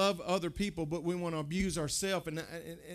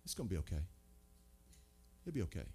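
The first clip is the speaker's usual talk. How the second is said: much lower, softer placating murmur; mock soothing friend who withholds truth; hushed soothing; 11 semitones lower, 12 dB quieter, much faster than usual. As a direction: pat them on the head with words. Soft false comfort, then rejected.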